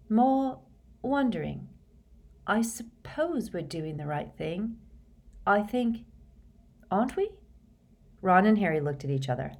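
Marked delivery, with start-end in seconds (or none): 0.1-7.3 halting higher voice, long gaps between phrases; slow and hesitant. A narrator's reading. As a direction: feel your way uncertainly to a question. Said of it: "said Hermione slowly".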